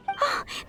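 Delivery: a quick, soft, delighted gasp; small airy gasp of delight. Acted. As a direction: catch your breath in happy surprise. Delighted at offer of help.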